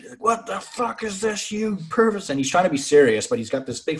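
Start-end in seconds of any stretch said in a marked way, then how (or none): 0.2-2.3 high-pitched, drawn-out imitation of losing it; mock outraged outburst. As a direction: act scandalised, voice way up without shouting. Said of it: "he fucking loses his shit".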